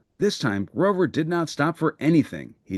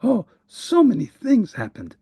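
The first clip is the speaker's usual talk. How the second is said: panting; 8 semitones higher than usual. Acted.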